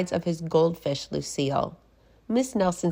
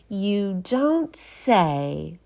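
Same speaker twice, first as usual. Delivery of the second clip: very slow, drawn-out sly witch; mock surprise, dragged out; 3 semitones higher, much slower than usual. Miss Swamp teases the principal, very slow and sly. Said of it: "said Miss Swamp".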